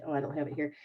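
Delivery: usual speaking voice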